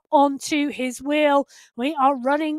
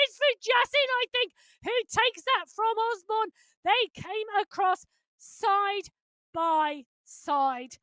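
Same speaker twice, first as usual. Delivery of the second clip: excited commentary; 7 semitones higher than usual. Real, not acted. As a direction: call the photo finish at full pitch. Sprint finish called live.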